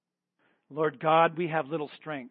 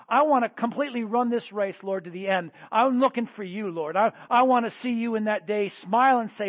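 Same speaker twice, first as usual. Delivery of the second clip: praying aloud for the congregation, pleading; fervent prayer; 2 semitones higher, 5 dB louder than usual. Acted. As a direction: lead the people in earnest prayer.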